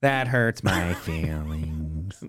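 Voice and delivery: slow flat robot voice. Pretend. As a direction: talk like a robot, slowly. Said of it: "you're a robot boss".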